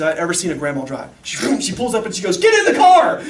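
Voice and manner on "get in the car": high, loud bellowed command; retold urgent shout. Grandma pulls up to the dog chaser, urgent shout. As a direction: act out yelling from a car, full voice.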